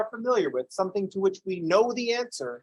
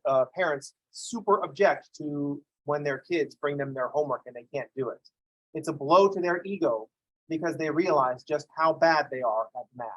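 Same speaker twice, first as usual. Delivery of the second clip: usual speaking voice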